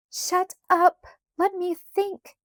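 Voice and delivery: high, exasperated outburst; screeching. A narrator's reading. Laura silences screaming Carrie, screeching. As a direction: screech at someone to silence them. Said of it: "Laura screeched".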